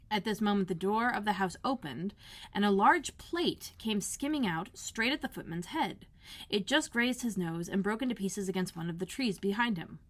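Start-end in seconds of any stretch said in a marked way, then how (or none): none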